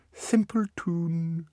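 put-on slow, high child sneer; scornful child. Miles insults his brother, a slow high sneer. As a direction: drawl it down at the little brother.

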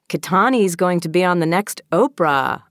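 lightly voiced teasing boy; teasing announcement. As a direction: announce it as a cafeteria tease. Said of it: "hear him in the cafeteria announcing".

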